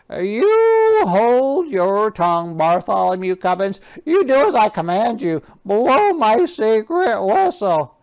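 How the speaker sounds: loud, high, commanding king; bossy king. Acted. King orders page Bartholomew, loud and high. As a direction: bark orders like a pompous king.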